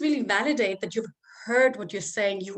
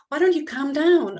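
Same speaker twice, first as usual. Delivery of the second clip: impatient parent; 6 semitones higher, 7 dB louder than usual. Retold or imitated. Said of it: "we tell them what to feel instead".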